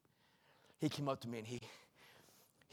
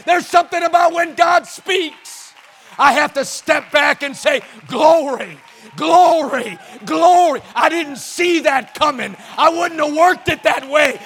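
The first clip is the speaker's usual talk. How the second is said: loud, high, shouted sermon cadence; fiery revival preacher, building each line; full-force preaching; 11 semitones higher, 19 dB louder than usual. Acted. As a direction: preach it out to the whole room.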